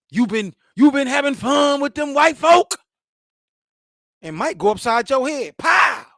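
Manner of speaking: put-on loud, high scolding shout at a boy; high-pitched mock threat from the aunties. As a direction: yell at him like an angry aunt. Aunties scolding him, voiced.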